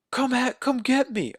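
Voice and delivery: high-pitched mock dare; girl daring the killer. Voices girl taunting the killer.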